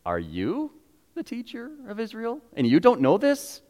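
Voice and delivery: high, hushed disbelief; gently shocked he doesn't know; a high, astonished question; incredulous rebuke. Retold or imitated. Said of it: "Jesus goes".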